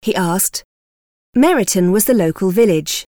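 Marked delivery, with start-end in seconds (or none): none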